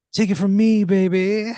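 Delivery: high, loud breathy croon; breathy talk-down. Acted. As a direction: purr it like a glam rock star. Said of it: "he goes".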